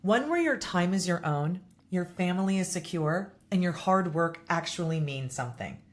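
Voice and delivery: soft, measured sponsor voice; host reading a property management ad. Acted. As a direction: read the ad smooth and steady. Sponsor ad read.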